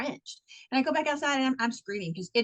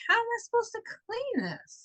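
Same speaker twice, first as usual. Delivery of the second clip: higher-pitched put-upon complaint; retold bewildered protest, pitched up; exasperated question; 11 semitones higher than usual. Asking how to clean it, exasperated.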